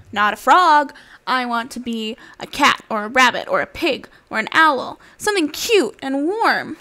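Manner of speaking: lively storybook voice with animal voices; reading to children. Acted. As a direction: read it like a bedtime picture book. Reads picture book aloud.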